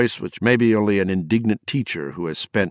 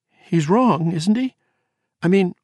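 higher, pleading, clutching at hope; a frightened wife begging to be told it isn't true; whimpering; 13 semitones higher, 5 dB louder, slower than usual. Acted. Wife begging husband for reassurance, whimpering higher. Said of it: "she whimpered".

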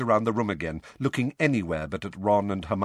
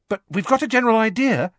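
high, fast, louder add-on; hasty; 14 semitones higher, 6 dB louder, faster than usual. Acted. Hermione sees Harry's face and adds more, hasty. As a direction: hurry it in to placate. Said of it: "she added hastily".

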